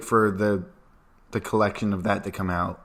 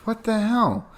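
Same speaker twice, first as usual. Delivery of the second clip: incredulous; 12 semitones higher than usual. Retold or imitated. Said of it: "we're like".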